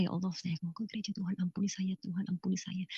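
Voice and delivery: very soft, pleading prayer under the breath; hushed retold prayer; murmured prayer. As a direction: murmur a repeated plea to God. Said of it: "saya sambil berdoa".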